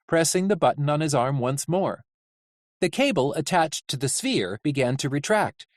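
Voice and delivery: ordinary delivery, nothing marked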